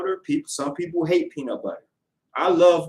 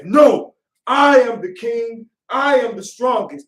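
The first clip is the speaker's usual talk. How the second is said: loud, slow, chest-out boast; hammed-up proud shout; insistent shout; 7 semitones higher, 9 dB louder, slower than usual. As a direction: declare yourself king, loud and drawn out. Man insists louder in debate.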